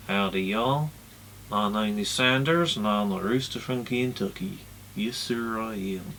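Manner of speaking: slow Southern drawl for a ventriloquist's rooster puppet; American southern drawl. Acted. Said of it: "thinking American, southern drawl".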